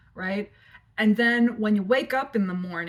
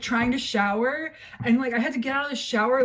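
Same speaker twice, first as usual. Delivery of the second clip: ordinary talk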